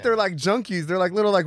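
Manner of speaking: usual speaking voice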